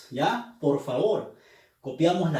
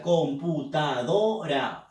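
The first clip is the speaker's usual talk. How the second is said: computadora stretched, weight on do; slow syllabified; slower than usual. Shown as a demonstration. Teacher pronouncing computadora slowly to show the stress. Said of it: "a ver, pronuncia".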